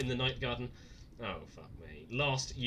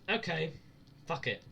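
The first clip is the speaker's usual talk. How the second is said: ordinary delivery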